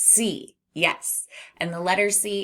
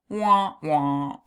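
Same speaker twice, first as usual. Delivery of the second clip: mock sad trombone; 5 dB louder than usual.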